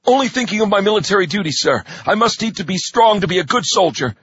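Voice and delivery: put-on young recruit voice. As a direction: pipe up at the sergeant. Recruit answers the sergeant.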